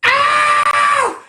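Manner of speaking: high piercing shriek; excited shriek. Real, not acted. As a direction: let out a sudden squeal. Shrieks at the thought.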